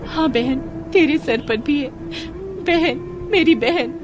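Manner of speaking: high, tearful cries of recognition; overwhelmed at finding a lost sister; emotional reunion. A narrator's reading. Long-lost twins recognise each other, overcome with emotion.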